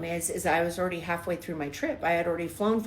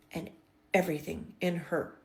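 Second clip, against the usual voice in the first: ordinary talk, nothing marked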